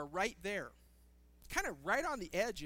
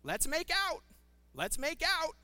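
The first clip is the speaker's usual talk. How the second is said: high-pitched parrot squawking its one phrase; female parrot; 8 semitones higher, 4 dB louder than usual. Acted. Female parrot repeating its only phrase, high parrot voice. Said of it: "the only thing it said was".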